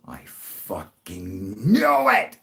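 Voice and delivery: drawn-out emphatic. Real, not acted. Recalls screaming at the sight.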